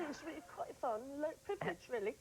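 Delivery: put-on high posh British accent. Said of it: "That's a good accent".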